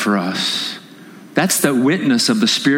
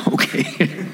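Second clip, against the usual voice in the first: breathy laughing okay; 2 semitones higher, 4 dB quieter than usual. Retold or imitated. Preacher mimics the startled groom, laughing. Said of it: "And then he goes".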